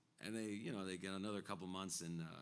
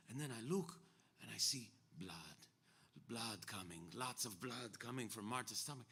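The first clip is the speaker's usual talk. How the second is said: imitated friend's excited eyewitness retelling; higher, dramatic accented account with dropped grammar; Ruben; 4 semitones higher than usual. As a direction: act out the horror, leaning on repeated words. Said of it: "This is how Ruben told it to me".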